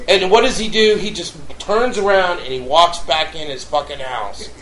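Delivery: ordinary talk, nothing marked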